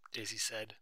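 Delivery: ordinary delivery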